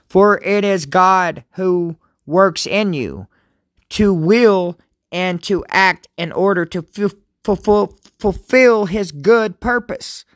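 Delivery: scripture reading. Acted. Pastor reading Philippians to his viewers, solemn and slow. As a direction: read each phrase as holy words.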